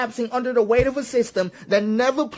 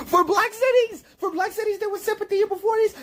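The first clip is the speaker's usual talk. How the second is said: very high, squeaky through laughter; laughing disbelief; 11 semitones higher than usual. Real, not acted. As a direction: squeeze the words out while cracking up. Repeats the question while cracking up.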